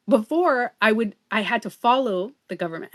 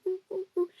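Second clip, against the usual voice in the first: soft, much higher cooing; pigeon cooing imitation; 10 semitones higher, 8 dB quieter, faster than usual. She imitates grieving pigeons, cooing high and soft. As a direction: coo softly like a sad pigeon. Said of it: "they went".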